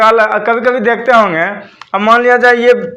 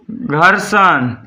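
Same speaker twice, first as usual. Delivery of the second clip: slow dictation; 4 semitones lower, much slower than usual. Shown as a demonstration. Teacher dictating list of forces.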